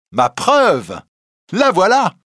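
high, triumphant outburst; triumphant cry. A narrator's reading. Young reporter presents his proof to the court, triumphant cry. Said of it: "Rouletabille cria, triomphant".

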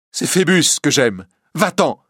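loud, high, angry dismissal; defiant rejection. Acted. Bohémienne struggling in the priest's arms, defiant. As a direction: throw it at him defiantly.

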